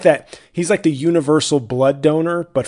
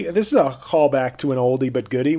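normal talking voice

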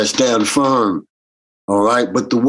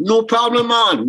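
high, laid-back "No problem, man"; breezy island ease; easygoing reply; 9 semitones higher than usual. Retold or imitated. Answering the doorman about smoking, an easygoing high reply.

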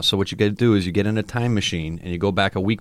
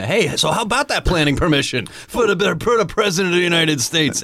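mock president working the phone, pitched up; pushy presidential caller; 9 semitones higher than usual. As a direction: call out the request in a raised voice. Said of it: "first call down to Argentina".

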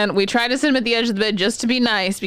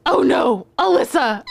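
imitated dismay; 4 semitones higher, 3 dB louder than usual. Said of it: "She goes".

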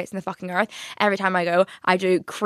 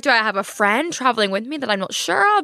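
ordinary talk

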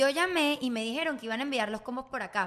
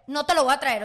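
retold refusal; 8 dB louder than usual.

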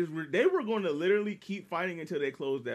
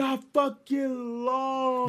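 slow, loud, higher taunt; mocking; 8 semitones higher, 4 dB louder, much slower than usual. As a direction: drag it out to rub it in. Mocking the heroes' loss.

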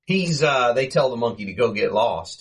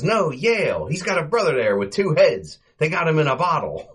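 higher quick cover-up Mo voice; imitating Mo; 6 semitones higher than usual. Mo covering for Larry in front of Morton, higher bluffing. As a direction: pitch up and bluff it out.

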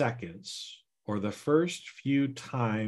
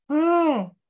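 high, loud surprised little "Oh"; the inner voice caught off guard; subconscious voice; 8 dB louder than usual. Acted. Subconscious starting to waver, high and surprised. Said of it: "my subconscious voice".

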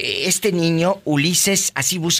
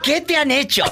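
mock indignation; 11 semitones higher than usual. Host acts indignant for her friend.

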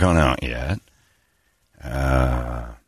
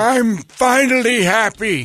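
high, slow hammed-up rocker wail; Jagger; 14 semitones higher, slower than usual. Orderly Jagger stumbling on song lyrics at work, high drawn-out wail. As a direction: draw the words out high, half-singing. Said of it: "Orderly Jagger".

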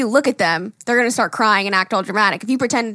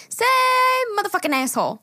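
put-on little girl; 12 semitones higher than usual. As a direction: coax slyly like a little kid. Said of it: "And she's like".

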